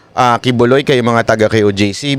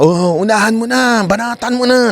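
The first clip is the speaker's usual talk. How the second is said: higher, tempting devil voice; devil's coaxing; 8 semitones higher than usual. Retold or imitated. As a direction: egg them on like the devil. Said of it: "Yung uudyukin ka". (boses ng demonyong nang-uudyok)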